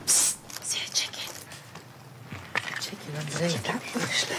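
hushed whisper. Real, not acted. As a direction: whisper so the chicken can't hear. Sneaking up on a chicken.

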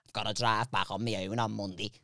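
low, very soft mournful-sounding mumble; Chris. Retold or imitated. Friend telling the teller he drove up, low soft voice. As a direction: drop right down and murmur it. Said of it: "it's just how he speaks".

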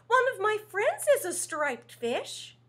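high, loud eager reply; perked up. Acted. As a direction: brighten up with hope. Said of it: "Rainbow Fish perked up".

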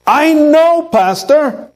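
high, breezy brush-off; mock congregant waving the pastor away; dismissive churchgoer.

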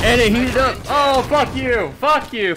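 loud, high furious cursing; raging gamer. Real, not acted. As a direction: yell at the overheated gun. Weapon overheats, cursing.

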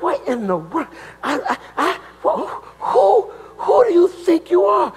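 hammed-up confusion at what was read; confused. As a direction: act out bewildered disbelief, tripping over the words. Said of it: "I've been so confused".

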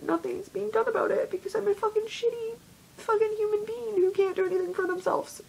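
real upset, voice pushed high; upset self-reproach. Real, not acted. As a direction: pitch climbing high, tearful self-disgust. Breaking down in self-blame.

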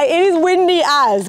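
usual speaking voice

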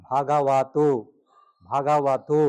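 ভাগাওয়াতো with long bha and ga and a wa sound; correct pronunciation. Shown as a demonstration. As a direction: spell out ভাগাওয়াতো carefully. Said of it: "ভ থেকে ভা, গ থেকে গা". (সঠিক উচ্চারণ ভাগাওয়াতো)

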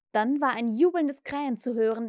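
plain reading voice

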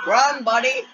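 high, pleading call to the screen; urgent plea. Real, not acted. Host cheering on a fleeing siren, high urgent plea. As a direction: urge the little one to run. Urging creature to flee.

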